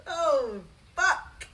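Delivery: ordinary delivery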